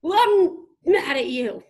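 put-on loud, slow teenage outburst; angry teen shout. Teen yelling at dad on the phone, loud and angry. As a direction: yell it like a furious teenager.

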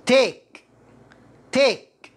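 "take" with an "ay" vowel, falling. Shown as a demonstration. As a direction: model "take" crisply as a word alone. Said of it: "take مكسورة بسبب ال A". (نطق "take" بكسر الـA)

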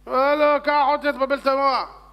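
loud, high outburst; exasperated passenger yelling; angry protest. Acted. Passenger angrily orders driver on.

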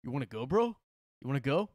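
loud, high "you wanna go, bro"; shouting a dare at another man; tough guy challenge. Retold or imitated. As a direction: bark it like a bar brawler. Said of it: "He gets up and he's like".

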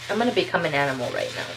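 fast, tumbling words; rushed excited aside. Real, not acted. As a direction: blurt it out in a rush. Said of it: "I'm really excited".

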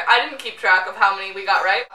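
usual speaking voice

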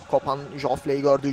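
ordinary talk, nothing marked

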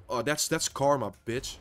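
ordinary talk

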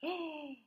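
sharp high gasp of suspense. Acted. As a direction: gasp as if something is found. Storyteller gasps in suspense.

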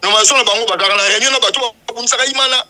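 loud, forceful charge; heated accusation. Real, not acted. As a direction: accuse them at full volume. Heated accusation against the committee. (kofunda na mongongo ya makasi)

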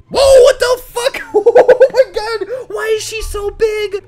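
high, loud shriek breaking into laughter; shocked laughing. Real, not acted. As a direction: blurt it out in real shock, cracking up. Sudden shock at giant avatar.

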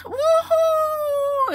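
very high mock-child woohoo; kids cheering. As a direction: whoop like a kid on a ride. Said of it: "them kids in the back was just".

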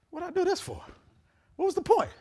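high exasperated whine. Retold or imitated. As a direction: squeak out the complaint. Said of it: "I'm like".

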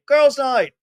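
put-on girls' night shouted high; loud high emphasis. Shown as a demonstration. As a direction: punch girls' night loudly. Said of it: "you know, when all the girls' night".

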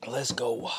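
breathy whispered let's go; whispered hype. Real, not acted. Eating alone, hyping his meal in a whisper. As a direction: whisper it to yourself, pumped.

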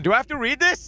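whining. Real, not acted. Reluctant about long text.

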